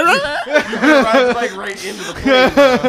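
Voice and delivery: talking through laughter; slow words while cracking up; laughing. Real, not acted. Laughing at the plane bit.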